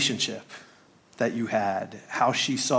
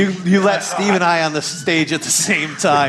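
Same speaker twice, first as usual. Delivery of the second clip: loud teasing complaint; 6 semitones higher, 11 dB louder than usual. Real, not acted. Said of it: "teasing complaint".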